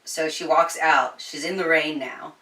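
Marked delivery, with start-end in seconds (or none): none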